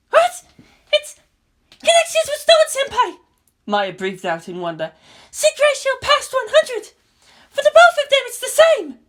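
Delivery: breathless wonder; amazed technician. A narrator's reading. Maya amazed.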